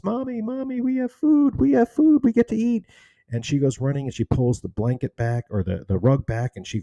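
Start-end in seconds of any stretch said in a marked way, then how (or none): 0.0-2.9 put-on very high, loud child calling out with glee; calling to mommy. Little girl runs to tell her mother, very high gleeful calling. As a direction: call it out like a thrilled little girl running home.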